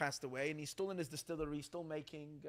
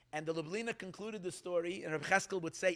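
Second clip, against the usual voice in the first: ordinary talk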